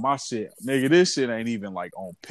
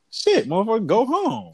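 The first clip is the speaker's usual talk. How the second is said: mock inner voice; 9 semitones higher than usual.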